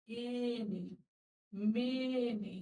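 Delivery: "মেনি" with a long, slow first vowel; downward "এ" sound. Shown as a demonstration. Teacher showing the downward vowel, drawn-out "মেনি". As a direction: draw out the e in "এনি", "মেনি". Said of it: "এটা ডাউনে চলে যাচ্ছে".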